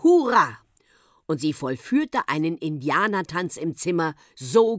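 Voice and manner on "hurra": loud, high hurrah that falls away; jubilant shout. A narrator's reading. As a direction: shout it out in glee. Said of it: "schrie Henriette".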